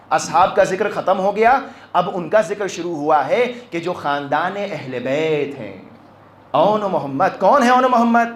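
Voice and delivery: ordinary talk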